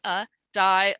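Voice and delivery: schwa uh then die, sounded apart; sounding out. Shown as a demonstration. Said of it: "it's probably the schwa sound".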